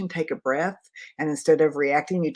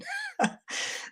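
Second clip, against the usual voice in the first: high laugh; 8 dB quieter than usual. Real, not acted. Joke about Wizard of Oz.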